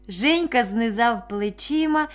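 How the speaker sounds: ordinary delivery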